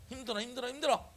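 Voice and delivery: high, complaining imitation; mock whining. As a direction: whimper it rising, as if worn out. Voices believer refusing to pray. (힘들다고 칭얼대는 흉내)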